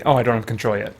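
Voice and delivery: ordinary talk, nothing marked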